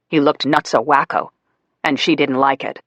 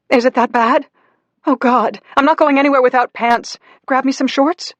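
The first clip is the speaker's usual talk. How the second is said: panicked Mommy; 10 semitones higher than usual. Acted. Burned Mommy ordering Daddy for shorts, high frantic. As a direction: rush it out in pain.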